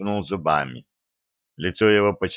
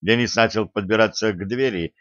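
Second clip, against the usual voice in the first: ordinary delivery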